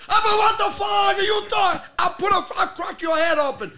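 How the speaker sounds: high, loud mock furious yell; old timer yelling. Old man in the club yelling threats at a young guy, loud and furious. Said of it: "He goes".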